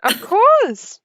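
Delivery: very high, sing-song reply; gracious local. Acted. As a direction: trill it politely as the local. Local answering thanks.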